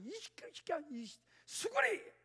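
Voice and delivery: put-on high, stretched angry yell at a whining child; losing temper at son. Father losing patience with fussing son, mock angry yell. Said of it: "달래다가 막 승질이 나는 거예요".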